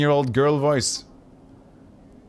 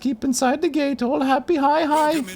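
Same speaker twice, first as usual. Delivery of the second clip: put-on silly girl voice. Pox explaining the dustmen in a silly high voice. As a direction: drag it out like a little girl. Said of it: "I'm doing a silly voice because the game told me to".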